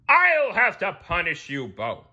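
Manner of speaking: high loud king voice; regal verdict. A narrator's reading. Neptune punishing both, loud regal voice. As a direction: pronounce the sentence. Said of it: "King Neptune replied".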